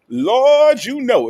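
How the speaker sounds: high drawn-out call. Shown as a demonstration. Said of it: "gotta put a little shake in your voice".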